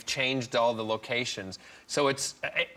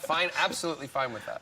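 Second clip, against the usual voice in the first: normal talking voice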